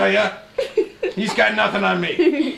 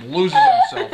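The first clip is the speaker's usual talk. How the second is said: sudden high squeal; 3 dB louder than usual. Pretend. Squeal mid-joke.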